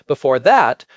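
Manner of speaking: normal talking voice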